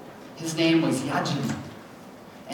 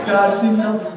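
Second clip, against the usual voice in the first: pleading prayer; 4 semitones higher, 7 dB louder than usual. Retold or imitated. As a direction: beg again, a bit calmer. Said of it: "I started praying".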